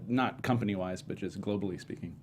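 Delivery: ordinary delivery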